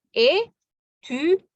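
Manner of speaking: étudiant drawn out slowly into syllables. Shown as a demonstration. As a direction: stretch étudiant for the class. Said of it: "Ada empat suku kata".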